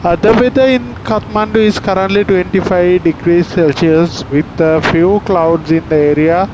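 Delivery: calm airline PA voice; formal in-flight announcement; captain announcement. Real, not acted. Pilot's cabin PA announcement.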